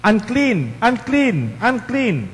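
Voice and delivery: high, ringing warning call; leper's cry. Retold or imitated. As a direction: cry it out as a leper keeping people away. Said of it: "You will shout".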